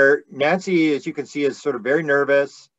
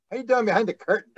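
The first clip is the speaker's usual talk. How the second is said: teasing; 6 semitones higher, faster than usual. Retold or imitated. Teasing Nancy hiding behind curtain.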